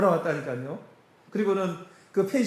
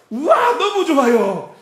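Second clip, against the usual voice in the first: slow, high, loud delighted wow with a laugh; 8 semitones higher, 8 dB louder, slower than usual. Retold or imitated. Church members admiring the pine grove, excited and laughing. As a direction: gush over the view like a happy guest. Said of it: "우리 사람들이 딱 보더니".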